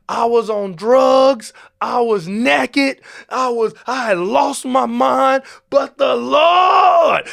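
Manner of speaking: rising, slow, climbing to a shouted "but the Lord"; hammed-up church testimony, building from slow and high to a full shout; testifying church sinner. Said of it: "The ones where they like".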